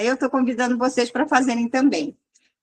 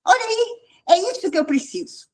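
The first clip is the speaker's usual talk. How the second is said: much higher, awed exclamation with a crying edge; excited mock gasp; 9 semitones higher than usual. The girl amazed at the advice, high and moved. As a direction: gush like it's the answer she needed. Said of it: "Aí ela virou pra mim e falou".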